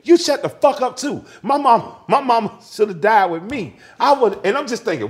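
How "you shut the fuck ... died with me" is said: loud, high retold outburst; furious mother. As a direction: act out a grieving mother lashing out. Voices mother snapping in argument.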